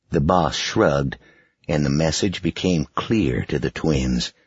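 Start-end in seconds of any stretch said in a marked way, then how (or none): none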